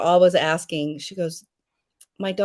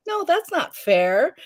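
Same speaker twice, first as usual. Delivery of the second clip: higher, slow mock protest; 11 semitones higher, slower than usual. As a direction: whine it's not fair.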